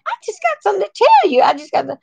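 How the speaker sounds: high, fast giggly imitation; mock tipsy giggle. Mimicking the tipsy guest, high and giggly. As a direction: ape her giggling drunk voice. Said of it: "she was saying that".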